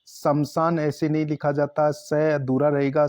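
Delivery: normal talking voice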